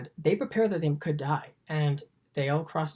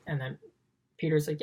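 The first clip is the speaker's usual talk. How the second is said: usual speaking voice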